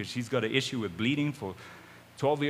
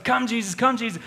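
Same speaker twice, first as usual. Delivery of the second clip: urgent tugging plea; 10 semitones higher, 6 dB louder than usual. Acted. Jairus pulling Jesus along urgently.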